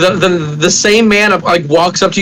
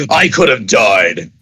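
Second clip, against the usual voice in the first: much lower, slow dramatic moan; mock dramatic; 10 semitones lower, slower than usual. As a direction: drop low and slow, milking it. Naked man voiced by GM.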